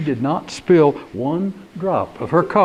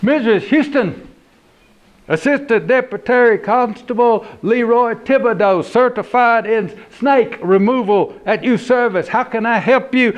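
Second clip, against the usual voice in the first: Leroy's phone voice; 6 semitones higher than usual. Acted. Cousin Leroy answering the phone, officious. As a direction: puff up the title.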